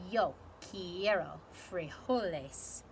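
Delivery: low, slow, solemn proclamation; somber declaration. Acted. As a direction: declare it like a grave leader. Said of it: "in his most somber voice declared".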